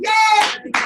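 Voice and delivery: high, loud whoop; excited cheer. Real, not acted. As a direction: cheer for a surprise guest. Cheering Malcolm's arrival.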